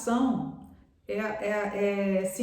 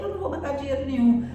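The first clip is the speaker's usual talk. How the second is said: loud, fast, higher-pitched refusal; acted annoyed dad, raised voice; indignant father's refusal; 5 semitones higher, 5 dB louder, faster than usual. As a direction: refuse in a huff, rushing it. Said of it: "o pai leu aquele telegrama e falou".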